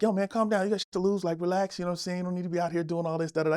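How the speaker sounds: soft, calm talking down; calming advice. Retold or imitated. Aries Spears calming the comic, soft reassuring voice. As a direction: ease him down gently. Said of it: "just was like".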